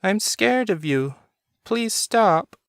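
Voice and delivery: put-on high, frightened child pleading; a scared son begging his father to stop; tearful little boy. A narrator's reading. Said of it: "with tears in his eyes".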